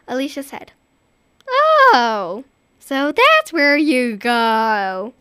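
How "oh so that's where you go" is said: drawn-out knowing remark; slow wry child. Acted. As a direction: drawl it, realising. Child finding the shoes.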